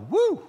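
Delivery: high, loud "woo"; short, high-pitched impressed whoop; admiring whoop. Acted. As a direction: whoop as if wowed by a fancy outfit. Imagined admiring reaction to luxury outfit.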